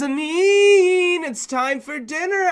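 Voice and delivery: mother calls her son down to dinner; mom calling. Retold or imitated. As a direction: call up the stairs like mom.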